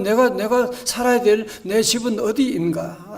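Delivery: ordinary delivery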